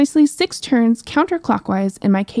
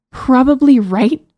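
scoffing; 4 semitones higher, 5 dB louder, slower than usual. Acted. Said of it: "the princess scoffed".

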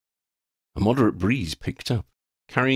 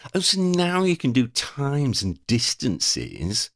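high, slow indignant complaint; peeved high-pitched grumbling; complaining; 7 semitones higher, slower than usual. A narrator's reading. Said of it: "Freddie complained".

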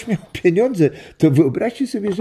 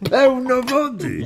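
slow, laughing reveal of the wet boot; laughing punchline; 10 semitones higher than usual. Acted. Grandpa laughing as he tells grandkids the boot story. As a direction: chuckle the punchline out slowly.

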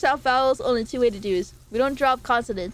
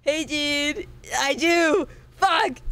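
SoCal surfer dude voice, pitched up; surfer dude accent; 4 semitones higher, much slower than usual. Retold or imitated. Streamer testing if she sounds like the video, surfer accent. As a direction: try on the California surfer drawl. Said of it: "I do talk, talk like that".

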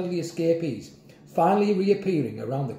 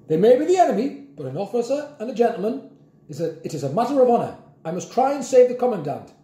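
put-on raised, resolute old-officer voice; honourable officer; 8 semitones higher, 4 dB louder, faster than usual. A narrator's reading. Grandpa justifying rescue of his enemy, resolute. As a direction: proclaim it with stout military resolve.

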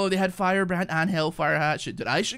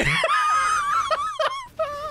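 high shrieking laugh; 5 dB louder than usual. Real, not acted. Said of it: "I'm fucking crying".

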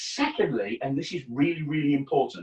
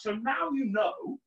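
usual speaking voice

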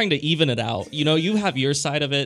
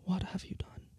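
very soft, high whispered melodrama; whispered transatlantic; 6 semitones higher, 13 dB quieter than usual. Shown as a demonstration. Showing co-host the whispered version, whispered transatlantic accent. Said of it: "if you whisper it".

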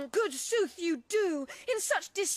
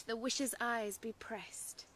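whispering; 8 semitones lower, 8 dB quieter than usual. Acted. Falling asleep whispered.

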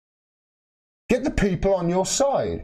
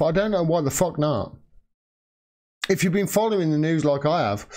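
usual speaking voice

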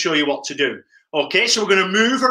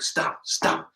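whispered stamping; 8 dB quieter than usual. Pretend. Elephant stamping demo.

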